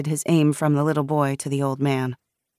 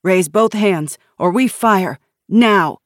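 loud, higher armed threat; barked ultimatum; 4 semitones higher, 7 dB louder, slower than usual. A narrator's reading. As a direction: order it with rising force. Jason threatens to shoot.

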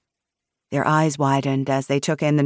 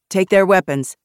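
raised voice of command; firm instruction; 4 semitones higher, 6 dB louder than usual. A narrator's reading. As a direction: order a teammate firmly. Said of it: "Nash instructed".

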